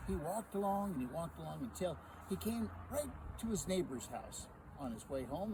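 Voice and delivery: plain reading voice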